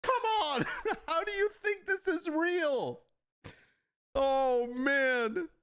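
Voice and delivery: very high-pitched squeal of real hilarity; laughing disbelief. Real, not acted. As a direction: exclaim incredulously while cracking up. Cracking up at fake photos.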